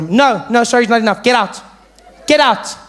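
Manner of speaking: high, loud dismissal; teacher shouting. Acted. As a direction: throw the pupil out, voice climbing. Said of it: "teacher would shout at you".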